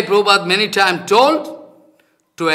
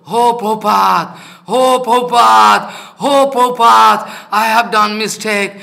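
retold anguished cry; 3 semitones higher, 4 dB louder than usual. Teacher re-enacts a repentant man crying out to Prabhupada, mock anguished wail. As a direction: call on the guru as if begging forgiveness.

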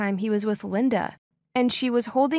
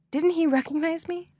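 ordinary delivery, nothing marked

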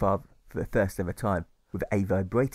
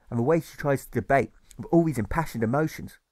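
ordinary delivery, nothing marked

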